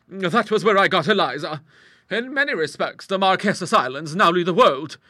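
high, loud, assured character voice; big-voiced matter-of-fact lady; strong-willed hostess. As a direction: explain it heartily and without concern.

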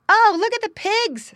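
put-on high, loud child's call; excited cry. A narrator's reading. Child spotting the pigs, crying out. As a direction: cry out in delighted discovery. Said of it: "someone cried".

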